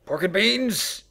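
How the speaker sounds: high, loud triumphant cry; a loud, gleeful game-show reveal; excited shout. Pretend. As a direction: announce it like a grand prize.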